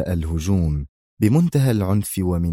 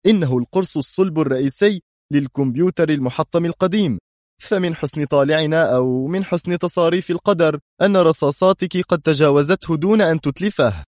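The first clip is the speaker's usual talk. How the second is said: higher-pitched eager explanation; enthusiastic; 7 semitones higher, 3 dB louder than usual. A narrator's reading. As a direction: announce the lucky find with excitement. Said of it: "أجابها بنفس الحماس".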